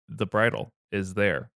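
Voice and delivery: usual speaking voice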